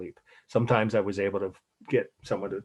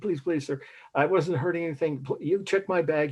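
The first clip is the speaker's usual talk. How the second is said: flustered pleading; 7 semitones higher than usual. Retold or imitated. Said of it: "I was tongue-tied".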